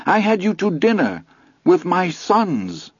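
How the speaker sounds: high, indignant outburst; crying out. A narrator's reading. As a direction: cry it out, hurt and outraged. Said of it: "Missus Kelly cried".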